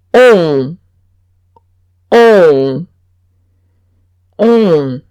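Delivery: slow, closed model of on; rounded, closed nasal on. Shown as a demonstration. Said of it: "cierro más la boca".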